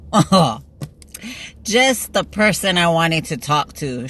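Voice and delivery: loud drawn-out "oh", then a slow, pointed line; teasing phone pounce; mock-pleased greeting. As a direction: greet them like you've caught the culprit. Mock greeting on retold call.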